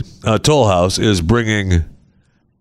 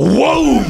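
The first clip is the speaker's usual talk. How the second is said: cartoon whoosh of the ride; whoa of the ride hitting. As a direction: sound the swing slamming back in. Ride swings back and hits.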